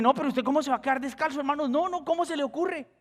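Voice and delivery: high, quick disbelief; incredulous protest. Retold or imitated. Pastor again refuses stranger's shoes, high and quick. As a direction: rush the objection up high.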